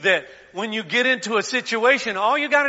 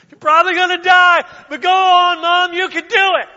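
put-on high, loud child voice with a laugh in it; little daughter; 10 semitones higher, 13 dB louder than usual. Little daughter urging her mother to jump, gleeful child voice. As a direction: cheer Mom on like a gleeful kid. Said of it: "the little daughter say".

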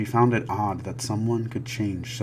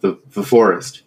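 loud, stammering terror; stammering fear; 2 semitones higher, 15 dB louder than usual. Acted. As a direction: choke out the words. Terrified father stammering.

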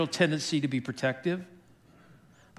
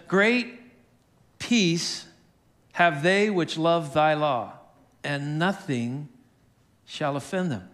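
scripture reading; slower than usual. Acted. Pastor repeating the psalm to the congregation, slow scripture reading. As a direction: open strongly, then space the phrases out slowly. Reads the verse again.

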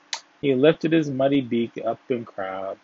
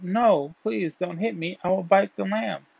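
mildly urgent, higher-pitched plea; pleading cry; 7 semitones higher than usual. A narrator's reading. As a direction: cry out in a high pleading voice, without real shouting. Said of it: "the dog cried out".

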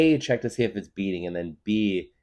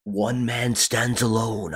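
slow, raised, epic narrator; movie trailer voice; 4 semitones higher, much slower than usual. Acted. Trailer guy continues the hero's tale, in movie trailer voice. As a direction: stretch each word like a trailer announcer. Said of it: "The movie trailer voice?".

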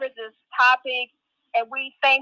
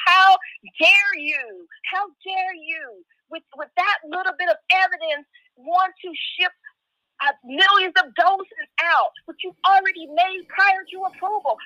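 high, indignant mock rebuke; retold angry outburst; 6 semitones higher than usual. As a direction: scold them with raised pitch, indignant. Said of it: "angry because I said".

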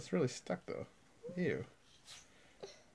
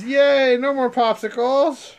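teasing fake enthusiasm, louder; mock cheer; 6 semitones higher, 11 dB louder, slower than usual. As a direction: cheer it to tease. Parent teases about vegetables.

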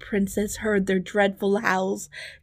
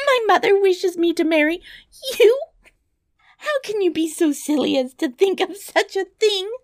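high, loud incredulous princess; indignant disbelief, pitched far up; appalled cry; 13 semitones higher, 5 dB louder than usual. Acted. Princess scorns the dwarf's proposal, high appalled cry. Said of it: "cried Bellissima, starting back".

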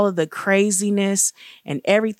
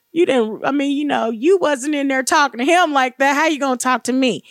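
offended protest; 7 semitones higher, 4 dB louder than usual. Retold or imitated. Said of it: "I almost got offended".

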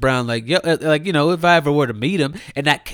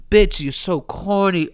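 ordinary talk, nothing marked

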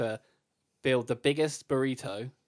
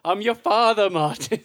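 loud, high Geordie Alan Shearer impression; put-on Geordie footballer voice; Geordie accent; 6 semitones higher, 7 dB louder than usual. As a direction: announce it in broad Geordie. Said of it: "That's how good my accent is".

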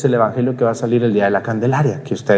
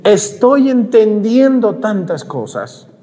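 amazed parishioner; 9 semitones higher, much slower than usual. Retold or imitated. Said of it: "Otros me dicen".

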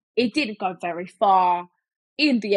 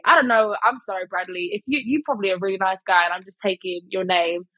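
usual speaking voice

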